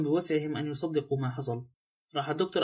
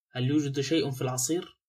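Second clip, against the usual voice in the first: ordinary delivery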